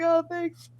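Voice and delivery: high-pitched retold thanks; light, hammed-up gratitude; mock thanks. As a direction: thank him in a put-on high voice. Acted reply to unhelpful man.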